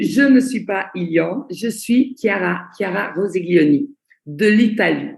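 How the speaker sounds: normal talking voice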